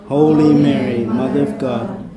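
ordinary delivery

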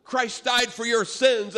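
high, loud, drawn-out proclamation; preaching the gospel. Retold or imitated. Miguel preaching at hostile workers, fervent and loud. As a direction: proclaim it like a zealous street preacher. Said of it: "preaching the gospel".

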